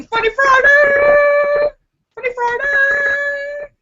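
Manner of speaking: high, slow, loud sing-song shout; show opener. Real, not acted. Opening Funny Friday for her viewers, high sing-song shout. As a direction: announce it like a game show host. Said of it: "announces show segment".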